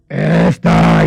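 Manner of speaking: deep, very loud booming voice; big booming voice. Retold or imitated. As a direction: drop the voice low and boom it out. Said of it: "me sacó un vozarrón así". (vozarrón grave y muy fuerte)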